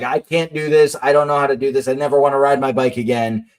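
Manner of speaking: normal talking voice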